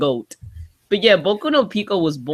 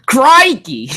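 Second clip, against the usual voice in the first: loud Aussie exclamation; Steve Irwin impression; 6 semitones higher, 7 dB louder than usual. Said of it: "Steve Irwin".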